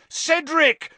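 loud high bellow of a name; bellowed warning. A narrator's reading. Harry warning Cedric of the spider, bellowed. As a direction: bellow it across the maze. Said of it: "Harry bellowed".

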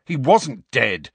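loud, slow, emphatic correction; angry. A narrator's reading. Student Harry correcting the professor, angry. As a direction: interrupt angrily, hitting each word. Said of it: "said Harry angrily".

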